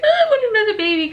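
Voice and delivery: high, loud, fast gush; eager. Retold or imitated. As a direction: gush with longing. Eager for another.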